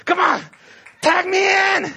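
loud, high shout of an eager tag partner; acted ringside plea, at full pitch; excited shout. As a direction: beg to be tagged in, yelling. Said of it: "he's just getting excited".

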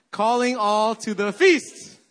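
"feast" punched loud at the end; stress on FEAST. Shown as a demonstration. Pastor leading church vision chant, stress on "feast". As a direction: build slowly, land hard on "feast". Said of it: "you emphasize every syllable".

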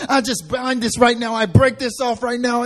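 high, loud, hammed-up sing-song binding prayer; zealous prayer. Team member shouting prayers over the sick, hammed-up. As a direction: chant it like an overzealous intercessor. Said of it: "they were hecka zealous".

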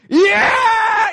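high, loud karate yell; hammed-up karate kiai; battle cry. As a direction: let out a mock fighting shout. Miyagi attacks with battle cry.

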